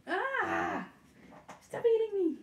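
high, loud yelp at the nibbling puppy; playful squeal. Real, not acted. Owner squeals at puppy chewing on her, high and loud. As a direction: squeal it out as the pup bites.

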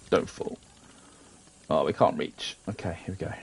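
ordinary talk, nothing marked

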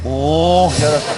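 ordinary talk, nothing marked

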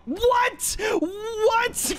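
high-pitched disbelieving yelp; incredulous outburst. Real, not acted. Player reacting to a sudden setback, incredulous high cry.

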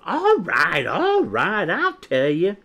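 high, loud hasty giving in; crying out. A narrator's reading. Quinny stopping Chick from leaving, crying out. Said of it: "Quinny cried out".

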